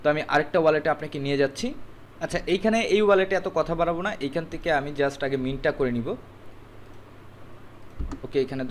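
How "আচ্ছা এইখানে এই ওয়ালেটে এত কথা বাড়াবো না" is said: ordinary talk, nothing marked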